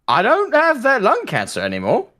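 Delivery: very high-pitched mock patient; gassed patient. Mock gassed patient looking on the bright side, high-pitched. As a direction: play it high, like a cheerful casualty.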